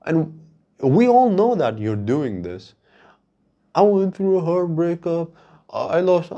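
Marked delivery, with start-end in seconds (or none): 3.7-6.3 high, whiny tearful imitation; mock crying. Mocking a man posting his heartbreak online, whiny fake crying. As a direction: put on a sobby social-media confession. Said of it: "I see you fucking crying like that".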